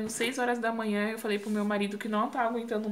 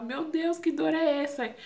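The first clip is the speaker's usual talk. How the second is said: retold pain cry; 7 semitones higher than usual. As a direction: imitate crying out in pain at a contained volume. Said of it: "Abaixava e gritava e falava".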